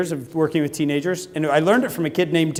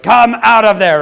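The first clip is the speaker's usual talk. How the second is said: higher, much louder yell; yelling through a door; 6 semitones higher, 9 dB louder than usual. Retold or imitated. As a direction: pound on the door with your voice. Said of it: "you're like".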